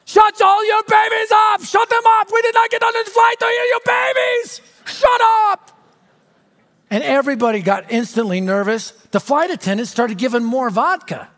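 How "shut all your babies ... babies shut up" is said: big guy's high, loud bellow, imitated; Ivan Drago-like yelling. Big angry passenger to the moms on a midnight flight, yelling. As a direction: yell it as a hammed-up Rocky villain. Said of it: "A big guy starts yelling at the moms".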